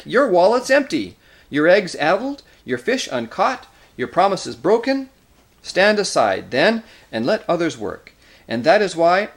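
loud, high string of accusations; angry tirade. A narrator's reading. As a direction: hurl each charge at the failed magician. Nickabrick attacks the doctor in council.

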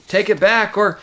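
loud, high demand, cut off; angry threat. A narrator's reading. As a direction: demand it, ready for a fight. Acted quarrel, threat cut short.

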